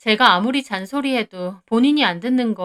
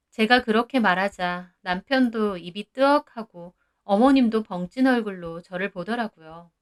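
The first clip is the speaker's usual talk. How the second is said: ordinary delivery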